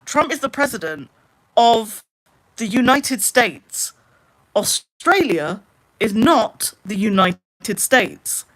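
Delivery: slow, stretched, emphatic explanation; laboured, as if to someone not getting it; exasperated spelling-out. Real, not acted. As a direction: spell it out word by word, slowly. Spelling it out to supporters.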